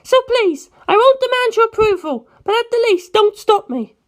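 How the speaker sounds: put-on a high, fierce girl set on revenge; Penelope's voice. Penelope pleads and promises.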